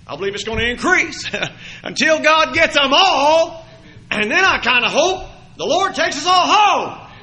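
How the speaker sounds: loud fervent preacher voice; preaching. Acted. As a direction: drive it home from the pulpit. Preaching at full force.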